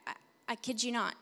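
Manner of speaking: ordinary talk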